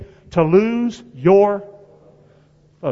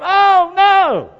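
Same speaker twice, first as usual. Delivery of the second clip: higher, louder fretful "Oh, no"; mock whining oh no; 11 semitones higher, 4 dB louder than usual. Preacher mocking distracted believers, whining loudly. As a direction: cry out "Oh, no" like a panicked whiner.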